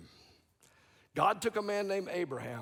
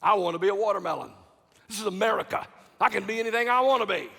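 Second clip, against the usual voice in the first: hammed-up proud cantaloupe voice, louder and quick; boastful cantaloupe; 3 semitones higher, 7 dB louder, faster than usual. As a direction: play a vain fruit bragging. Preacher voicing a boastful cantaloupe.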